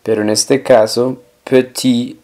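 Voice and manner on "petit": petit said "puh-TEE", ending on the vowel; silent final T. Shown as a demonstration. Teacher showing a silent consonant, petit without final T. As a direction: leave the last T of petit unsounded. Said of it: "la T del final no suena".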